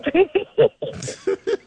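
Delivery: high-pitched cackle; high burst of laughter. Real, not acted. Laughs at bad game.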